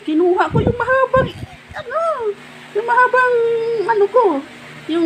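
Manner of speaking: flustered, squeaky hunt for the name of the thing; high flustered search. Real, not acted. Searching aloud for the stick, flustered and high. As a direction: voice jumps way up while groping for the word. Hunting for the word, voice shoots up.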